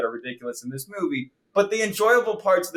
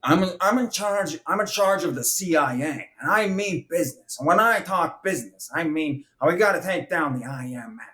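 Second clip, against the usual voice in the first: mock American accent spoken through the nose; stilted nasal monotone, parodying an American accent; nasal fake American accent; 2 semitones higher than usual. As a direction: do a British actor's artificial, super-nasal American. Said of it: "try to speak through their nose in this monotone".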